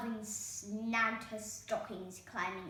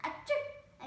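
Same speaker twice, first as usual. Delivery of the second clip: sneeze; 6 dB louder than usual. A narrator's reading. Cinderella sneezes.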